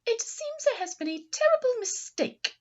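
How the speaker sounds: high, loud fussy lady voice; Missus Bulldog. Acted. Missus Bulldog to Missus Poodle, high fretful lady voice. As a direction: play the bulldog mother fretting. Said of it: "Missus Bulldog said".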